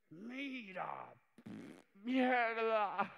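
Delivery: high, then loud dismayed cry; dismayed shout. Acted. Boss failing his proof, high loud dismayed cry.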